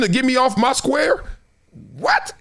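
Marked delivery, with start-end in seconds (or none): 2.0-2.3 What? squeaked way up high; a shrill startled what, hammed-up; mock outrage. Heckler gets a mock-startled squeaky what.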